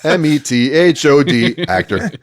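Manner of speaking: M E T H O D spelled loud and high, letter by letter. Shown as a demonstration. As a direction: spell M E T H O D out, each letter hit. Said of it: "spelling it out".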